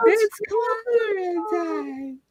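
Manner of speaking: high, slow, stretched scream; screamed catchphrase. Pretend. Club crowd screaming the catchphrase in unison, high and stretched. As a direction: scream it out slowly. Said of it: "everybody screams in unison".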